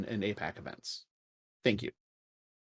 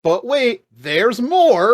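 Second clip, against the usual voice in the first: hammed-up TV salesman hype, much higher and louder; infomercial pitch; 10 semitones higher, 11 dB louder, much slower than usual. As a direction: sell it like a late-night ad host. Mock infomercial pitch line.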